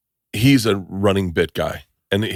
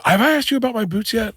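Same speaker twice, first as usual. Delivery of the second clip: mock eager question; 11 semitones higher than usual. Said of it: "later he'd go".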